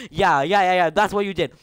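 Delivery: ordinary talk, nothing marked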